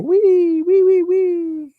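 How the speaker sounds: high, slow, squealing wee wee wee; commercial pig squeal. Retold or imitated. Painter imitating the pig from the old commercial, high squeal. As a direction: squeal like the happy pig in the car. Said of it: "that pig on the commercial".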